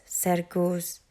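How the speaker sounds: ordinary delivery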